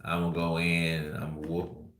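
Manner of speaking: low, soft, slow mumbling imitation of Errol Spence; lazy-tongue Texas drawl. Vlogger imitating Errol Spence's lazy Texas speech for fans. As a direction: slur it lazily like a Texas fighter hyping his bout. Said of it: "they just have a lazy tongue like this".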